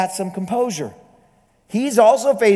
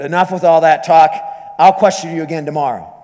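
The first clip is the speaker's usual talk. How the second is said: louder, fast and curt; gruff dismissal; 5 dB louder, faster than usual. Retold or imitated. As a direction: cut her off gruffly. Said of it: "The questioner grunted".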